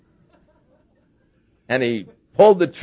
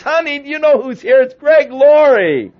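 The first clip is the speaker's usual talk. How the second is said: higher, louder excited call to his wife, "Honey, do you know who's here?"; calls out to his wife, thrilled and much higher; 10 semitones higher, 5 dB louder than usual. Retold or imitated. Excited rancher calls to his wife to announce Greg Laurie. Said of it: "and just so excited".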